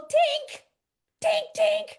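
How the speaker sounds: ping each "tink" way up high like a bad note; squeaky, high imitation of a broken piano key. Piano's out-of-tune key sound.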